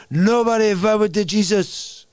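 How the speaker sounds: lightly voiced swaggering bowler; a hint of menacing Latin bowler boast; Jesus from Lebowski. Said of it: "That's freaking everybody from the movie".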